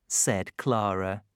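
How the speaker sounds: ordinary delivery, nothing marked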